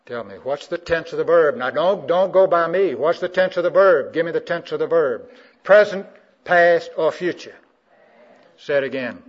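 loud, high, insistent questioning; forceful preaching. Real, not acted. Preacher pressing the congregation for the verb's tense, forceful preaching.

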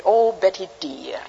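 a gentle hint of a Scottish accent, slow; slow old Scottish lady's greeting, lightly done. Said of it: "She had a Scottish accent".

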